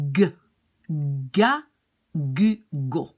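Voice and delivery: hard g as in go, before A, U, O; clipped hard G syllables, one by one. Shown as a demonstration. Teacher shows the letter rule, hard G. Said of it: "it will be pronounced like".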